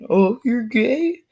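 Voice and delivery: high, very drawn-out taunt; mock taunting bully. Bully repeats the insult, mock teasing. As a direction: stretch out a high, silly taunt.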